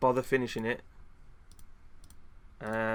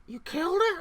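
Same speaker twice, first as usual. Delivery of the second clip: much higher, squeaky mock outrage, getting louder; outraged friend; 5 dB louder than usual. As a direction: squeal it like a shocked buddy in disbelief. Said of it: "person that's your friend, going".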